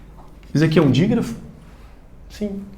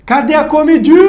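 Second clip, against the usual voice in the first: high, loud call stretching the "di" in "comidinha"; demonstrated shout; 11 semitones higher, 5 dB louder than usual. Shown as a demonstration. Teacher calls for food to show the stress, high shout on "comidinha". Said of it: "Onde o seu grito mais estender".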